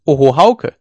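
loud, admiring call; called from the crowd. A narrator's reading. A voice from the crowd cheers Hauke's throw with a loud call. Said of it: "rief es aus dem Haufen".

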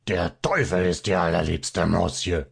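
a touch of an angry little man; furious yell. A narrator's reading. Little man snaps back at Fabian in mild anger. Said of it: "schrie der Kleine ganz erbost".

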